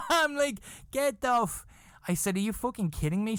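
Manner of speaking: high, squealing laugh into an incredulous protest; shocked disbelief. Retold or imitated. Acts his shocked reaction to spiders.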